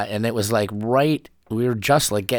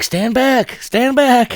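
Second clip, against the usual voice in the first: high, loud call to stand back; urgent mock warning yell; warning shout; 7 dB louder, much slower than usual. Bystander warning the crowd off a burning couch, urgent shout.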